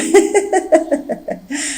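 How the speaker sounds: a long helpless giggle; maid's giggle. Acted. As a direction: laugh in his face. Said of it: "Nicole n'arrête pas de rire".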